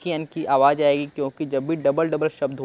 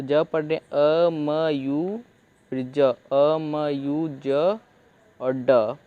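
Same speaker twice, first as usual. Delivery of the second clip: slow syllable demo; much slower than usual. Shown as a demonstration. Teacher blending syllables of amused, very slowly. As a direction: sound out a-m-yu-z very slowly. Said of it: "तोड़ तोड़ के पढ़ लेते हैं".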